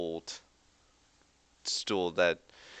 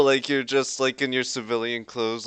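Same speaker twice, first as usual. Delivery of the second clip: usual speaking voice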